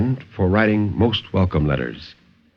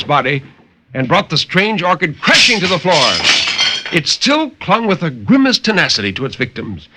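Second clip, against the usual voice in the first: dramatic story narration. A narrator's reading. Narrator reading a horror tale to radio listeners, dramatic and intense. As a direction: tell the horror tale with rising urgency, then settle.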